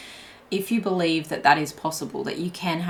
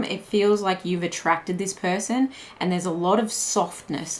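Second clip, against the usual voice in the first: usual speaking voice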